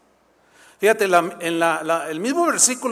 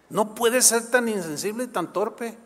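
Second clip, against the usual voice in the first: ordinary delivery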